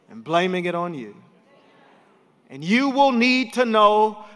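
rising to a shouted climax; preacher lifting the church about Jesus, building to a shout; preaching at full force. Acted. Bring it home, loud and high.

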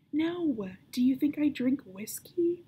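put-on outraged girl snapping back; indignant denial. A narrator's reading. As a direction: bristle at the accusation.

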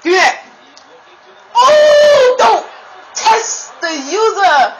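high, loud, slow taunt; taunting shout. Real, not acted. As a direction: gloat loudly at the opponent. Taunting opponent at peak.

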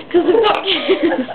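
can't keep it together through the plea; mock pleading dissolving into laughs; laughing plea. [name] pleading, laughter breaks in.

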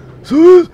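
a sudden high, loud laugh; sharp high laugh bursting out; burst of laughter. Real, not acted. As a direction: crack up out loud. Sudden laugh while driving.

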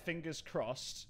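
low, soft self-talk; muttering to oneself. Real, not acted. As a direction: think aloud under the breath, low and hushed. Mutters to herself alone while playing.

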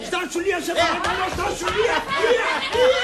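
high, desperate calls after someone leaving; urgent high-pitched entreaty; frantic pleading. Acted. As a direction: beg a wife to stop, voice climbing. Husband begs wife not to go.

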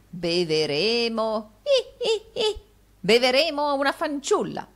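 ridacchia acuto e lento; beveremo, trascinato, con risolini stridenti; risatina da vecchio. Acted. (risolino di vecchio avaro alla fanciulla)

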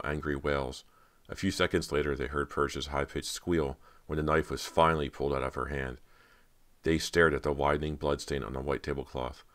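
usual speaking voice

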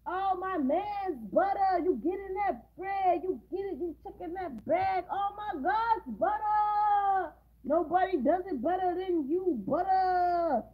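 high, loud, drawn-out hype man gushing over Butter. Retold or imitated. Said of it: "to be the hype man".

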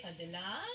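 Adela with LA held long and rising; stretched last syllable. Shown as a demonstration. Music teacher showing how not to say the name, stretched last syllable. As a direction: draw out and lift the end of "Adela". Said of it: "then the notes will be different".

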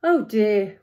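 a touch of storybook worry; fretting lightly as the giraffe; worried giraffe. Acted. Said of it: "worried the giraffe".

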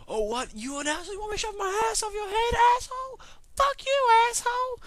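building into a very high shriek; squealed, over-the-top insults; mock angry screeching. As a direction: start indignant, climb to a hammed-up screech. Acted quarrel building to screamed insults.

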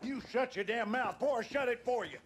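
snarling, loud threat; angry threat. Acted. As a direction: spit the threat at her. Father threatens wife.

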